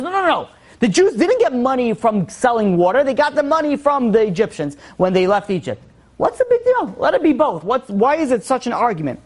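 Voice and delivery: high, animated protest; exasperated objection. Acted. Speaker acting out rabbis' dispute over Egypt's money, exasperated objection. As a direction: protest it like a baffled debater, pitch jumping up.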